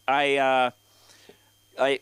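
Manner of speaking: ordinary talk, nothing marked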